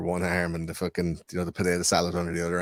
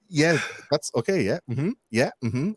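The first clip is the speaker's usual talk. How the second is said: polite, uneasy humouring; 7 semitones higher, slower than usual. Retold or imitated. Listener politely enduring his rant.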